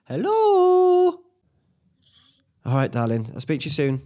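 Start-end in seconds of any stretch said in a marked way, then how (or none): 0.0-1.2 phone hello. Real, not acted. Answering the phone.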